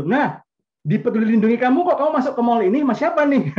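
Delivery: high, loud, fast mock accusation; hammed-up jealous wife catching him out; mock jealous wife asking who the man is; accusing wife. Wife confronting over mall check-in.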